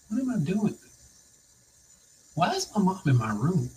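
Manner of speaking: mildly bewildered. Retold or imitated. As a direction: wonder it quietly to yourself, mildly confused. Retells waking confused in hospital.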